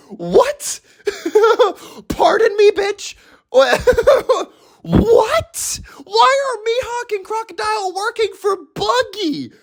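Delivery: very high incredulous squealing with laughs; hammed-up disbelief. Fan questioning why villains work for Buggy, incredulous squeal. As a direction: demand an explanation in a high squeak.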